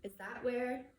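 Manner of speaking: plain reading voice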